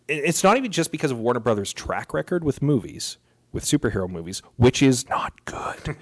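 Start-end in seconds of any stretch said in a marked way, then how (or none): 4.6-6.0 slow whispered jab; conspiratorial whisper aside. Acted. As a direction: drop to a whisper for the dig. Snide aside on track record.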